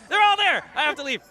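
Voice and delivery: high, loud mock panic. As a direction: squeal it in mock alarm. Panic over statues.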